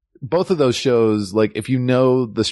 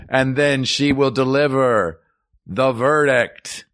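hammed-up court crier proclamation; mock royal decree; 7 semitones higher, slower than usual. Court crier opening the podcast.

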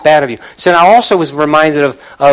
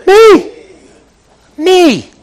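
emphatic shout; 8 dB louder than usual. Acted. Emphatic answer in sermon.

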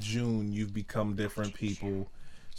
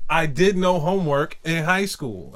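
loud, raised declaration; proclaiming to the world; 5 semitones higher, 8 dB louder than usual. Pretend. Teacher teased about confessing to the world, loud proud proclamation. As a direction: announce it as if from a pedestal. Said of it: "with a megaphone and tell the whole world".

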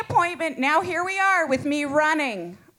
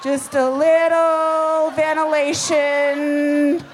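slow airy relief; slower than usual. Acted. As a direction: savor the air from the vent, slowly. Drying herself at the vent.

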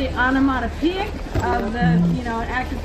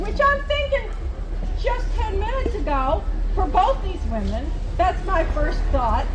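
preaching; 5 semitones higher than usual. Acted. [name] preaching at her tent revival.

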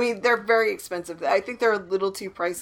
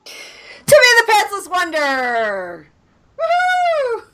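loud, higher whooping rally cry; mock cheer; 12 semitones higher, 6 dB louder than usual. Friends joking about going pantsless, rowdy mock cheer. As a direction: cheer it like a hype man.

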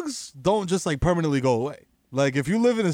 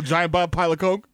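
ordinary talk

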